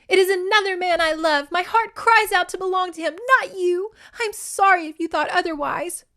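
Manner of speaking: high, impassioned heroine's plea; tearful refusal. A narrator's reading. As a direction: cry out a heartfelt confession, theatrically. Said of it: "tears streaking down her face".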